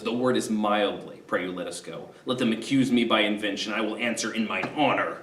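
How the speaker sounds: low, soft grudging promise; grudging reply. Retold or imitated. Coriolanus grudgingly agreeing to speak mildly, low. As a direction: say it through reluctance, held down. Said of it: "Coriolanus says".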